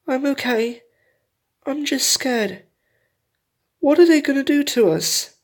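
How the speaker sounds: high, small voice of a frightened fox cub, trembling; a little cub asking what will happen to them, high and scared; scared young cub. A narrator's reading. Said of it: "before he spoke".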